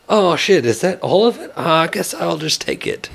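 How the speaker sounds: feigned surprise. Pretend. Pretending he accidentally got all the meat, feigned surprise.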